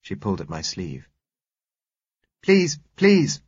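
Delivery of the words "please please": put-on high, loud child's plea; urgent little girl. A narrator's reading. Girl begs the passing driver for help, urgent. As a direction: beg urgently in a small girl's high voice. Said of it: "she said urgently".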